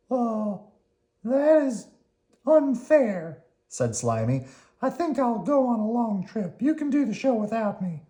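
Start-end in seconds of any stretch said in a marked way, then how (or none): 0.1-3.4 high, louder worm voice, protesting; Slimy protesting. Acted. Slimy complains to Oscar, in a high indignant worm voice. 4.8-8.0 high worm voice, sulky; a little worm announcing he's leaving; Slimy the worm. Acted. Slimy tells Oscar he's leaving, in a high sulky voice.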